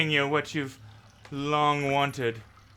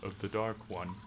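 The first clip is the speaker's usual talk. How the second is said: ominous warning voice; 5 semitones lower, 8 dB quieter than usual. Acted.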